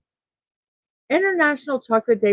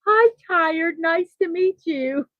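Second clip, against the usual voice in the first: higher, sing-song mock introduction; dad joke greeting; 8 semitones higher than usual. Mom teasing her hungry kid, playful high dad-joke voice. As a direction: tease the child with a chirpy hello.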